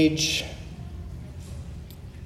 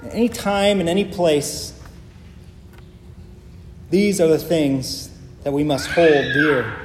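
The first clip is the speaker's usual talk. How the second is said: ordinary talk, nothing marked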